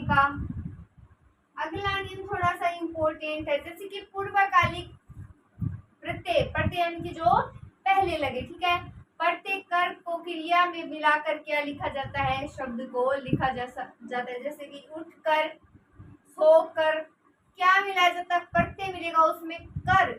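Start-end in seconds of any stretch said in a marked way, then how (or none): none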